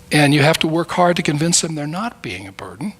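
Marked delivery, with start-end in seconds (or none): 1.6-2.9 whispered. Real, not acted. Unvoiced whispered line.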